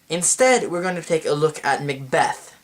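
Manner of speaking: ordinary talk, nothing marked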